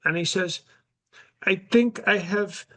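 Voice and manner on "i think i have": put-on high, slow European accent, dropping the article; Barron with a European accent. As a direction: son confessing to dad in a high European accent. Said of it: "he speaks with a European accent".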